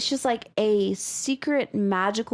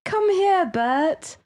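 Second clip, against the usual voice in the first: put-on British accent; 8 semitones higher, 4 dB louder, slower than usual. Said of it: "but in a British accent".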